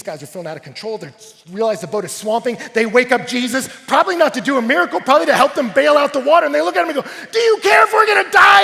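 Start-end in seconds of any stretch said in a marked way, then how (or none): 1.5-7.3 loud, high, rushed storm retelling; urgent preaching. Acted. Preacher retelling a swamping boat, urgent preaching. 7.3-8.6 very high, very loud outcry; panicked shout. Retold or imitated. Disciples waking Jesus in the storm, panicked shout. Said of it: "they start to panic".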